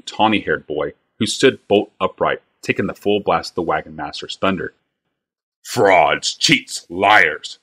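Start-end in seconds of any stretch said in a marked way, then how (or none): 5.6-7.6 a mild shout, word by word; shouted insults. Acted. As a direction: bark out each accusation. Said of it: "shouted Bly".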